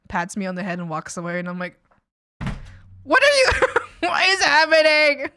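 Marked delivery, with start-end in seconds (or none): 3.0-5.3 much higher and louder, cracking into laughter; baffled squeal through laughs; what is going on, laughing hard; laughing disbelief. Real, not acted. Reacting to brother patting her head.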